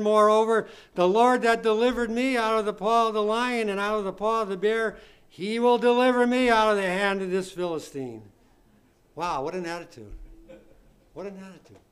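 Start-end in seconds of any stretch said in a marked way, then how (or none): 0.0-8.2 loud, emphatic Bible reading; declaimed scripture reading. Real, not acted. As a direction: proclaim the verse to the congregation. Reads scripture aloud with force.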